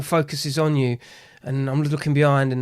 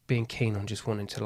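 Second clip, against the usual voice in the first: ordinary delivery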